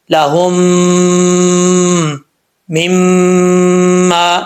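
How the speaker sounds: slow chanted recitation with long nasal ghunna; Quran recitation. Acted. Teacher reciting for students, slow nasal ghunna. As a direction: hum the میم of مما long through the nose.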